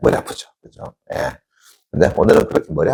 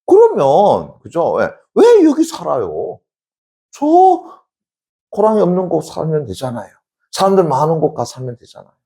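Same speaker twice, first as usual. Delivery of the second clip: puzzled disciple; 11 semitones higher, 3 dB louder than usual. Acted. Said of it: "그랬더니 자로가".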